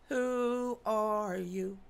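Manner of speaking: slow, drawn-out eerie who are you; spooky voice. Pretend. Asking the viewers who they are, slow spooky voice. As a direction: stretch every word like a ghost.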